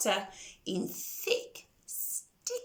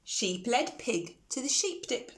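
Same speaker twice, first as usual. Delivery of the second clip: ordinary delivery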